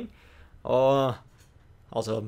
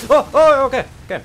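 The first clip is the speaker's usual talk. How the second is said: high, loud startled gasps; startled yelp; 11 semitones higher, 13 dB louder than usual. Real, not acted. Gamer ambushed by a thrown attack, loud startled yelps. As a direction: jolt at the surprise attack.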